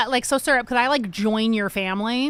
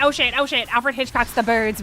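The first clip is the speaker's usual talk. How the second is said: normal talking voice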